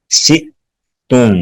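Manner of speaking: "شئتم" slowly, choked off at the hamza; hamza with jolt. Shown as a demonstration. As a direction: break "شئتم" with an abrupt glottal stop and pause. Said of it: "حمزہ پہ جھٹکا لگائیں گے".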